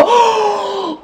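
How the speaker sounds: sharp high gasp of shock. Real, not acted. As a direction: gasp as the shot nears goal. Big chance hits the post.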